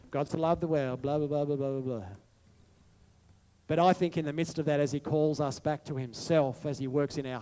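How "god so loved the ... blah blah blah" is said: quick, throwaway recital trailing into blah; rote recitation. Retold or imitated. Pastor mocking a flippant, rote recital of John 3:16. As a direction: rattle off the verse like it means nothing. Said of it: "you can just rote it".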